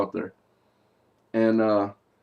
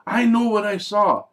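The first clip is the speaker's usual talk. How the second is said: mock insistent, louder and higher; adamant; 6 semitones higher, 7 dB louder than usual. The girl insisting to her friends, adamant and loud. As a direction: plant your feet and insist. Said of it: "adamantly tells them".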